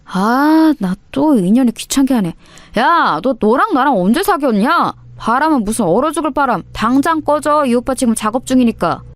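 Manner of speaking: swat her away like a pest; irritated, cocky senior shouting her off; annoyed brush-off. Acted. Thug brushing off ex.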